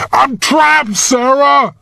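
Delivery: high, desperate cry of being stuck; rising panic, hammed lightly; boy stuck in the ice crying out to Sarah in panic; panicking boy. A narrator's reading.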